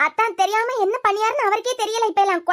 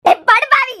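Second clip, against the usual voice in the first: loud, high outburst of outrage; shocked, enraged shout; furious curse; 5 semitones higher, 7 dB louder, slower than usual. Acted. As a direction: yell the insult at him. Mother curses drunk son.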